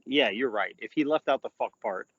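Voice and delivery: ordinary delivery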